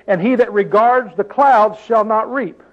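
slow, measured reading of the verse; scripture reading. Acted. Preacher rereading the verse to his listeners, slow. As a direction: lay the verse out slowly.

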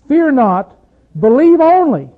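preacher voicing Jesus, bold and ringing; proclaiming Jesus. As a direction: lift it up as a bold promise.

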